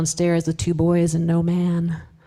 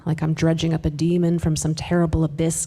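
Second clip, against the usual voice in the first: ordinary delivery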